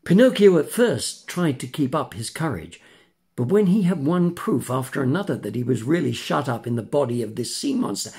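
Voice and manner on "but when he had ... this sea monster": usual speaking voice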